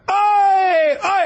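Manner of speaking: high, repeated strike cries; imitated fight yell. Retelling Mayhem fighting in the street, high shouts. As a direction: yell one out for each elbow thrown. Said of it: "he was just going".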